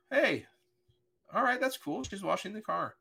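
high, bright mock-cheerful 'hey', pleased at first; cheery surprise. Dad pulling up, pleased to see his daughter washing the car. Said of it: "I'm like".

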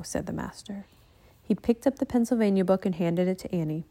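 ordinary delivery, nothing marked

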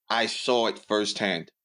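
ordinary talk, nothing marked

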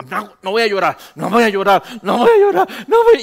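escalating refusal to cry, leaping high and loud; fighting back tears. Retold or imitated. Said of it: "llorando y no llorando me, me firmé".

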